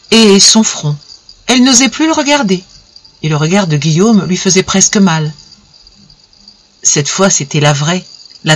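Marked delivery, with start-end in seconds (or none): none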